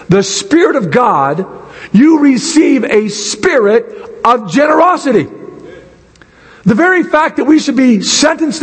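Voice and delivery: high, emphatic sermon delivery; impassioned preaching. Real, not acted. Preaches with rising force.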